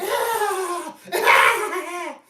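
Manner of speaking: loud, high mocking cackle; imitating her laugh as a loud, high shriek. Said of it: "and laughing".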